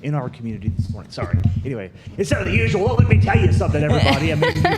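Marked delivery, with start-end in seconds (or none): 2.2-4.8 loud, high wrestling promo hype. Pretend. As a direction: shout it like a wrestler cutting a promo. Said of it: "I kinda like the wrestling voice".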